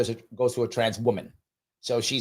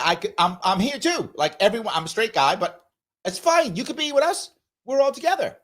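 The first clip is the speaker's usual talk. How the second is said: high, bright and inviting, voicing the friendly rainbow flag; welcoming flag voice; 8 semitones higher than usual. Voicing the rainbow flag to viewers, high and welcoming. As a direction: sing it out like an open invitation.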